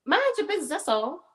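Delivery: sassy mock retort. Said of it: "But I'm just like".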